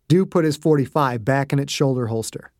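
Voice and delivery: plain reading voice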